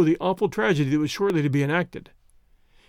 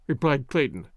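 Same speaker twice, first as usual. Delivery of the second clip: plain reading voice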